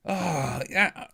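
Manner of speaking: ordinary talk, nothing marked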